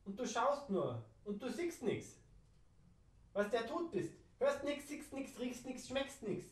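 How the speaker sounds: ordinary talk, nothing marked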